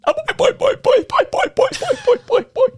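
put-on high springy boy boy chant; boing catchphrase. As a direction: bounce it like a cartoon spring.

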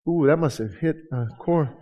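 soft knowing ooh; quietly teasing the crowd; teasing aside. Real, not acted. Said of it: "aside to congregation".

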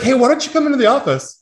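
mock manager. Manager voiced, high.

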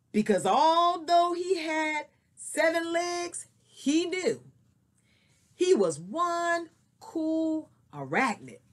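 expressive storybook reading; story narration. Acted. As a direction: read it like a picture book. Teacher reads story aloud.

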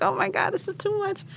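very high, soft squeal of disbelief; retold overwhelmed reaction.

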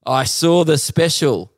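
slow, flat-out review; heckler's slow deadpan praise; deadpan verdict. Retold or imitated. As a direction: deliver it like a blunt critic. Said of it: "he's like".

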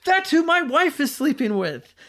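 high, loud imitation of stunned outrage; mock outraged realization. Husband realizes who his wife's lover is, acted shock. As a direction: blurt it like a man piecing it together.

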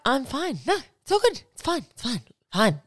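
angry underneath, said through a creepy sweet smile; fake smiling. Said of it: "the kind of people that can smile".

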